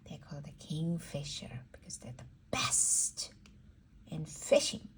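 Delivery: quick soft whisper; a hushed secret for a toddler. Acted. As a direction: lean in and whisper it fast. Whispered fact to child.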